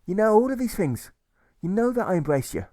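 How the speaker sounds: a man losing patience with inane questions; exasperated deserter. A narrator's reading. Deserter answering Alfred's inane questions, high and exasperated. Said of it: "the deserter replies again".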